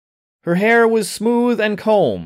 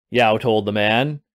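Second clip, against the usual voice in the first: ordinary delivery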